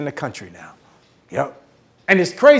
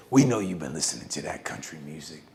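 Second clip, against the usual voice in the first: deep menacing friend; 13 semitones lower, 9 dB quieter than usual. Retold or imitated. Big friend catching him in the car, deep and menacing. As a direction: growl it like an intimidating giant.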